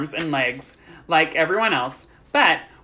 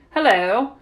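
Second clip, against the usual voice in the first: higher, uneasy hello; managed greeting; 7 semitones higher, 5 dB louder than usual. A narrator's reading. Girl greets boy's staring dog, uneasy. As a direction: manage a wary little greeting. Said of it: "she managed to say".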